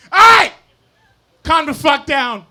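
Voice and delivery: high, loud burst, easing slightly; shouting down audience. Real, not acted. Yells at laughing audience.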